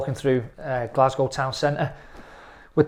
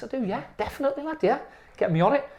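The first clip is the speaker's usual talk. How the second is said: eager acceptance; 11 semitones higher, faster than usual. Retold or imitated. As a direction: jump at the offer in a raised pitch. Retelling eager yes to offer.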